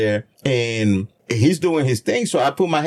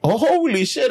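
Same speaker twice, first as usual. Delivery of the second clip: amazed excitement; 13 semitones higher than usual. Retold or imitated. Continues excited retold reaction.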